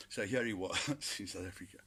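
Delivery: usual speaking voice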